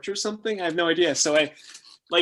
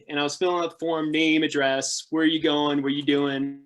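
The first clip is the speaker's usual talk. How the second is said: ordinary delivery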